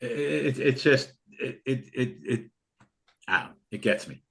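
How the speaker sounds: real choked-up halting words, dropping soft; real emotion, trailing into near silence; getting emotional. Real, not acted. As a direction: voice catching, struggling to go on. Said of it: "I get emotional".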